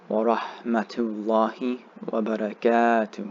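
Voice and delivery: wa barakatuh with the long a held; extended barakatuh. Shown as a demonstration. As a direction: draw out the a in barakatuh. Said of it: "Make sure you extend".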